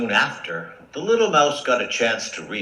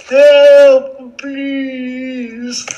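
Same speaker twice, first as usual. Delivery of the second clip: high, drawn-out wail; trapped lion's hammed-up cry; crying for help; 11 dB louder than usual. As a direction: yell loudly, then plead up high. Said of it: "heard his cries".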